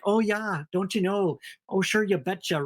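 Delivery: put-on folksy Minnesota accent. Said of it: "I have a terrible Minnesota accent".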